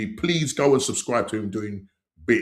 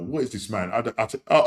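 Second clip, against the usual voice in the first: usual speaking voice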